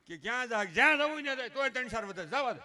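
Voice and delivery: loud, high-pitched exasperation; loud retort. Acted. Child told to go wherever, loud high mock exasperation. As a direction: throw it back forcefully. Acted quarrel over leaving.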